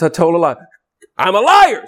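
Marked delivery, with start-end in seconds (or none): 1.2-1.9 loud, high outright admission; blunt confession. Acted. Preacher naming the specific sin, loud blunt confession.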